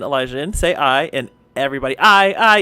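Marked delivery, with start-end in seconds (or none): 2.0-2.6 loud, higher voting cheer; crowd cheer. Acted. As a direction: shout aye with the crowd.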